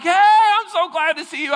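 high, delighted welcome; light up at seeing an old friend; happy greeting. Retold or imitated. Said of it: "you're like".

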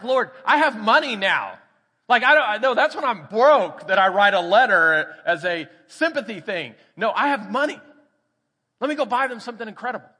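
high, loud, eager protest to God; excited protest. Retold or imitated. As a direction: insist with big excited energy. Pleading with God in retelling.

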